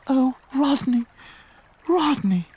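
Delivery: high, hammed-up swooning lady; melodramatic heroine. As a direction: overact the heroine. Leading lady again.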